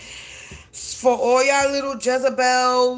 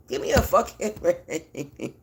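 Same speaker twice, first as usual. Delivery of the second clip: exasperated laugh; 6 semitones lower, 4 dB quieter than usual. Real, not acted. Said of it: "I'm going to go crazy".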